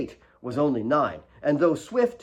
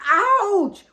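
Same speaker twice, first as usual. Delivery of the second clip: very high, loud yelp; sharp cry of pain; 9 dB louder than usual. A narrator's reading. Kate's knee stung by wet cloth, yelping.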